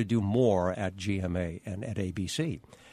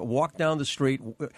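normal talking voice

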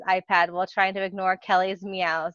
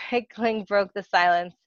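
plain reading voice

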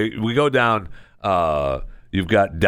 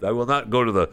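usual speaking voice

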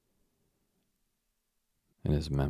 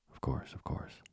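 hushed whisper, softer; 5 dB quieter than usual. Acted. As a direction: breathe it out secretly. Girls promising secrecy, whispering.

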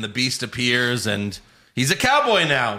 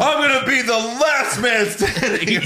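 much higher mock promo voice; mock Lashley promo; 9 semitones higher than usual. Host imitates Lashley's old promo, high hammed-up boast. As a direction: ham up a wrestler's boast. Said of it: "He goes".

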